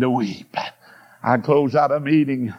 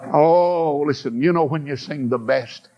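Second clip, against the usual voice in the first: normal talking voice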